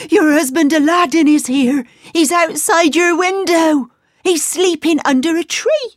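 breathless happy announcement; excited. Acted. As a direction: rush the news out. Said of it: "she cried".

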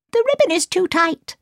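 high, urgent dwarf voice; a worried little dwarf crying out; high voiced dwarf; second dwarf. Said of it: "cried the second dwarf".

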